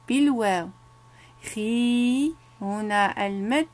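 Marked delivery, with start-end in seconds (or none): 1.5-2.3 خي held long as a drawn-out "khee"; sustained long-vowel model; long vowel demo. Shown as a demonstration. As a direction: stretch the "ee" in خي. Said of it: "سأقرأ عليكم".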